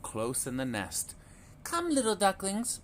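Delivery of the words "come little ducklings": sing-song calling mother duck. A narrator's reading. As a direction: call them over, mama duck. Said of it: "Mama called again".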